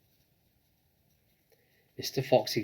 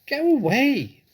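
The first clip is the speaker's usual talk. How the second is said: very high, loud shriek; shrieking rat; 12 dB louder than usual. A narrator's reading. Rat shrieks at the fox to go away. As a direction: screech it like a furious rat. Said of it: "shrieked the rat".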